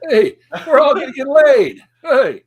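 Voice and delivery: very loud, high-pitched comic holler; stand-up comic impression. Doing the comic for the co-host, high hollered impression. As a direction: holler the gag as the comic would. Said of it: "I think I can do him a little bit".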